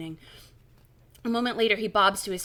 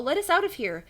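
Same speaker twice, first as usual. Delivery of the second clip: higher-pitched call for release; raised storybook plea; 6 semitones higher, 3 dB louder than usual. A narrator's reading. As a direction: call out to whoever might hear. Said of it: "you call".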